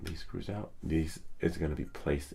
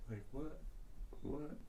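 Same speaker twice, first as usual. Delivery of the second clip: baffled; 10 semitones higher, 11 dB quieter than usual. Real, not acted. Computer says out of memory.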